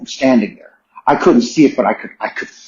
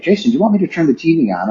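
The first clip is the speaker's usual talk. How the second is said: quick, higher nurse asking; lightly voiced nurse; 5 semitones higher, much faster than usual. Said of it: "the nurses several times were like".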